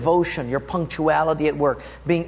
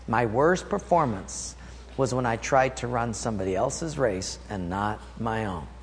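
quiet repeat; 5 semitones lower, 6 dB quieter than usual. Shown as a demonstration. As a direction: drop the voice to drive it home. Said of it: "Let me say that again".

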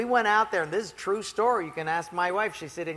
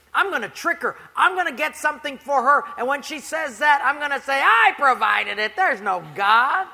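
higher, louder gleeful plotting; scheming neighbor; 6 semitones higher, 7 dB louder than usual. Retold or imitated. Atheist neighbor plotting her trick, loud and gleeful. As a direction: relish the trick.

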